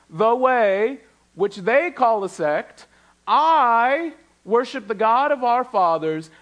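slow, high, ringing Bible reading; preacher reading out the charge against Paul, slow and lifted; dramatic scripture reading. Acted. Pastor voicing Tertullus's charge to the congregation, slow and lifted. Reading Paul's defense aloud.

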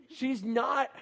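usual speaking voice

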